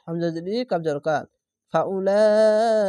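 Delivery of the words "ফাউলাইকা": high, loud, slow chanted recitation; long madd recitation. Shown as a demonstration. Teacher showing the four-alif stretch in recitation. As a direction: stretch the long vowel as in tajweed. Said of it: "চার আলিফ টেনে পড়বেন".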